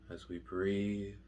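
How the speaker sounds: hushed, unhurried guidance, letting each phrase settle; guided meditation. Real, not acted. Meditation teacher guiding listeners through mindful breathing, slow and soft.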